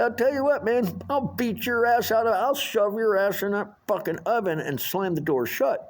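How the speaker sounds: bully him into backing off; threatening rant. Retold or imitated. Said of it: "I said".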